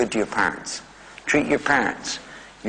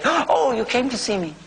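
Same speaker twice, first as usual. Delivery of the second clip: put-on higher, gentle old man voice; old father pleased to see his son; elderly father's voice; 10 semitones higher than usual. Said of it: "he would say".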